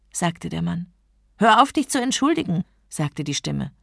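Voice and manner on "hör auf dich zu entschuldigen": quick, higher, louder order; annoyed. A narrator's reading. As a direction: cut off the apology impatiently. Annoyed genie cuts off apology. (ungeduldig und ärgerlich)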